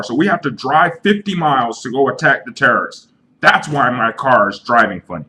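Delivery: high, loud and wild-eyed, shouting over everyone; a paranoid buddy yelling that it's terrorists; hammed-up hysterical conspiracy rant; frantic crazy friend.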